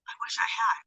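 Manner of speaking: whispered, almost to herself; wistful whisper. Real, not acted. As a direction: breathe it out like a wistful secret. Wistful aside about cheap yarn.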